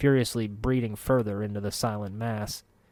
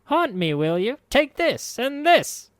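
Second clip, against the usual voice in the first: furious crone; 5 dB louder, slower than usual. A narrator's reading. Marta pounding corpse.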